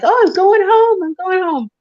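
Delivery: high, loud burst of joy, then easing; joyful relief. Retold or imitated. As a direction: sing out the relief of going home. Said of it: "Just like".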